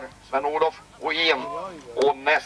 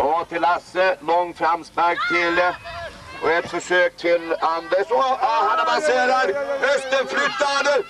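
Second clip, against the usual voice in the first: rising excitement, high and eager as the goal comes; live play-by-play building to a goal; 7 semitones higher than usual. Acted. Commentator calling the first goal in the park, building excitement. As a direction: ride the attack up to the goal.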